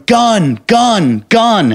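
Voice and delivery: mock-yell a one-word alarm; retold alarm call. Runner warning the cops, calling out high.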